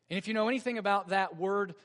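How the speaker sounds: usual speaking voice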